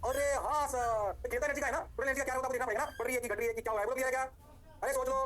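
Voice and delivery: excited teacher calling out to students; hyped call-out. Real, not acted. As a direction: fire the class up. Teacher hypes the class, calling out.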